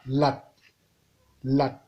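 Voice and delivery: "la", "Lat" said on a low level tone. Shown as a demonstration. Teacher shows the low tone, low level "Lat".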